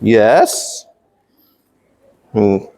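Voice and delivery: ordinary delivery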